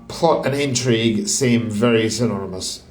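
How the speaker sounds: normal talking voice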